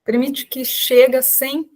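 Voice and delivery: unhurried calming voice with long pauses; guided meditation. Real, not acted. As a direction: guide listeners gently into breath and body, leaving space after each phrase. Leads a guided breathing meditation.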